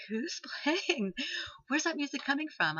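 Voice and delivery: high, eager, quick questions; breathless excited asking; excited calling out. Retold or imitated. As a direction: ask it all lit up with wonder. Asking where music comes from.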